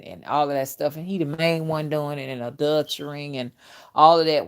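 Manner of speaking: usual speaking voice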